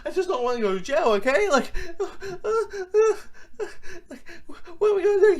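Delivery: high, fast, panicky whine with a laugh; a hammed-up panicking brother voice; mock panic. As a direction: play the nervous brother losing it. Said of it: "always, like, panicking".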